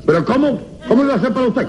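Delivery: high-pitched, scandalized disbelief; incredulous protest. Acted. Accuser challenging the defendant's absurd claim, incredulous. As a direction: burst out high in outraged disbelief.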